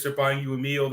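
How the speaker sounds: normal talking voice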